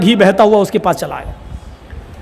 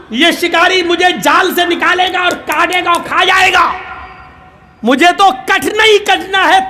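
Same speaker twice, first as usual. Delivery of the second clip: pigeon's exasperated scolding; 8 semitones higher than usual. Acted. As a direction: yell at the crow out of sheer frustration. Pigeon scolding crying crow.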